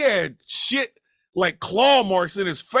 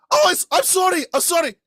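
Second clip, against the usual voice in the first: high-pitched, hurried sorry; light, high voice, backing down; acted apology; 9 semitones higher, faster than usual. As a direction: imitate an apologetic guy, voice pitched up.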